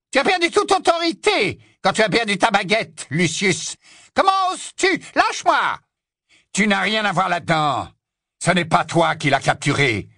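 high, shrill outrage; scornful fury. Acted. Witch fights off Lucius gripping her arm, scornful fury. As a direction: lash out in a high, indignant witch voice.

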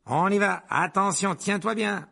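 Stan calls out, high and loud; high, loud warning. Acted. Conductor warns Harry to hold on, loud and high. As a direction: brace them for the jolt, up high.

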